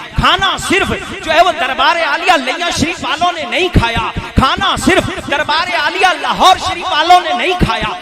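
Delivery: loud, high, impassioned sermon; rousing high-pitched pulpit oratory; full-force preaching. Real, not acted.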